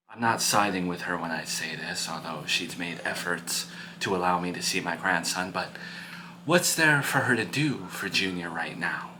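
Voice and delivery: low, hushed counsel to an upset son; calm, frank parent. Acted. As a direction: speak softly and low, measured and serious.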